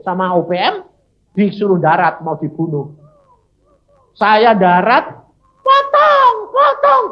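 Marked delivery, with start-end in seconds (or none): 5.7-7.0 high, loud shouted threat; armed rebels yelling at the captive preacher; threatening yell. Retold or imitated. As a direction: bark the order to cut. Gunmen threaten to cut heads.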